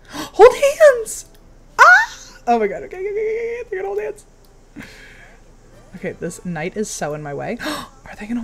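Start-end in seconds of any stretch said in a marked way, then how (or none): 0.1-2.4 gasping, shrieking high with delight; excited squeal. Real, not acted. Streamer squealing as her character's date holds hands. Streamer thrilled by game romance. 2.5-4.3 high, breathless okays; giddy excitement. Real, not acted. Streamer fangirling over the hand-holding. Romance moment in game.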